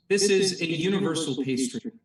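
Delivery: ordinary talk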